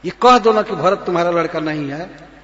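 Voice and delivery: loud, fast taunt as Kaikeyi; taunting queen. Acted. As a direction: throw the accusation at the king. (Kaikeyi का तीखा ताना)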